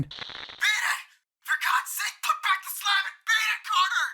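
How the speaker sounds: very high, strained desperate cry; piteous cry. A narrator's reading. Warren crying up to Carter to run, a very high desperate cry. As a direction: cry out in terror. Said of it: "a piteous cry from Warren".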